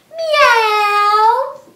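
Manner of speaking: high loud rising meow; a big cat meow. Shown as a demonstration. Teacher counting cat sit-ups with a loud high meow. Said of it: "cat sit-ups".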